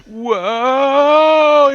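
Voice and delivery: long, loud, high 'whoa' yell; drawn-out cry of someone falling; acted falling yell. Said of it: "Boren's like".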